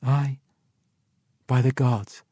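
understated, falling affirmation; woman's answer. A narrator's reading. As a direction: confirm it, letting the end fall. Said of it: "the woman answered".

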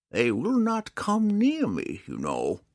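high, slow, secretive hermit; confiding old man. A narrator's reading. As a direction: share a secret, leaning in. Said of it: "bent over toward Drizzt with a whisper".

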